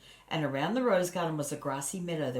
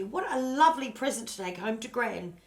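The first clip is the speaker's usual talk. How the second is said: higher, louder delighted exclamation; delighted cry; 7 semitones higher, 5 dB louder than usual. A narrator's reading. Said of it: "cried Annie".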